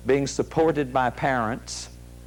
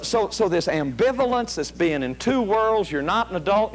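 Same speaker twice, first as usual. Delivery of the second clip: normal talking voice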